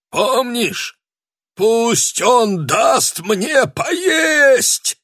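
creaky ghost, rising, pleading; high, slow, creaky begging; old prospector. A narrator's reading. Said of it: "скрипучим голосом".

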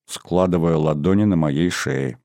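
ordinary delivery, nothing marked

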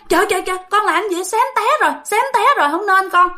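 put-on high, fast alarmed parent scolding a child; a parent startled, scolding a kid who nearly tipped the bike. Parent scolding a child who nearly toppled the motorbike, high and fast.

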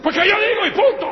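high, loud, laughing shout; husband yelling to end the argument. Retold or imitated. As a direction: slam the last word down with a yell.

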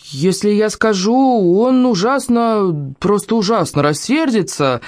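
slow, high, worried pleading; hesitant girl. Acted. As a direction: stall reluctantly, stretching the words. Girl hesitates, afraid to tell.

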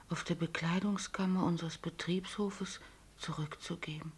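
reads the letter in a whisper; barely breathes the words out in shock; stunned whisper. Acted. Ehefrau liest Kündigung fassungslos vor. (fassungslos geflüstert)

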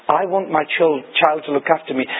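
usual speaking voice

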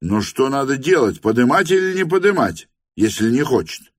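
bewildered cry. A narrator's reading. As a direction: cry out in confusion. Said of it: "крикнул офицер".

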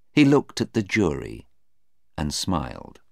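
ordinary delivery

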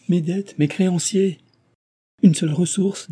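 high-pitched, agitated lament; worked-up, high exclamations; frantic. A narrator's reading. Ruined man frantic about his debts.

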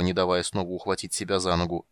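usual speaking voice

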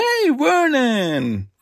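long, high sing-out hello; excited hail. Real, not acted. Fan greets a character's first appearance, drawn-out excited hail.

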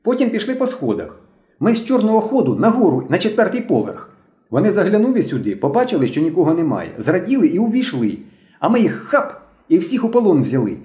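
put-on high-pitched excited boy; agitated report. A narrator's reading. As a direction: report eagerly to the leader in a high voice. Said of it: "знову швидко заговорив білобрисий".

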